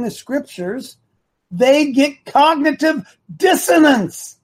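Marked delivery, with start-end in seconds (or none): 1.5-4.4 high, slow, emphatic outburst; worked-up, incredulous emphasis; exasperated. Real, not acted. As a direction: draw each word out in rising exasperation. Exasperated at emailers who argue back.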